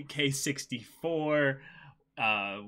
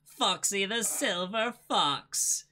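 high, slow hammed-up villain boast; pirate captain voice; 8 semitones higher, 4 dB louder, slower than usual. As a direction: announce the crew grandly. Introducing Foxy in voice.

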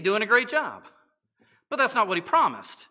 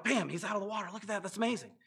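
softer, hushed awe; a hush of awe at what you're watching; hushed amazement; 8 dB quieter, much faster than usual. Retold or imitated. Onlookers watching Peter walk on water, hushed awe. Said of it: "We're like".

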